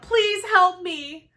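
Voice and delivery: high, loud and slow plea; a desperate cry for help; cried out. Acted. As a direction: beg for help, stretching it out. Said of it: "she cried out".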